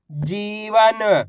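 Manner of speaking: "जीवन" with a long, stretched ई in जी; drawn-out vowel. Shown as a demonstration. Teacher blending letters into a word for learners, "जीवन" stretched long. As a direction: draw out the जी of "जीवन" very slowly. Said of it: "इसी प्रकार से खींच के पढ़ना".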